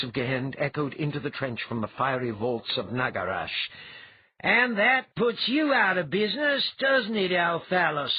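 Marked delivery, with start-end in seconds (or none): 4.4-8.1 loud, high, mocking villain; gloating chortle. A narrator's reading. Villain taunts Althalas through the portal, gloating chortle. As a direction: crow over your beaten enemy, loud and pitched up. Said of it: "Gehen chortled".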